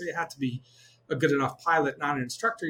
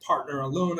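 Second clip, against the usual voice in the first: usual speaking voice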